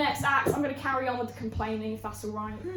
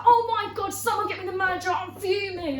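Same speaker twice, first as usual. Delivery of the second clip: raised, high-pitched outraged complaint; mock screaming customer; 8 semitones higher, 5 dB louder than usual. Furious customer demanding the manager, mock screaming. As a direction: imitate a fuming theatre customer. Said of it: "she's screaming, she's like".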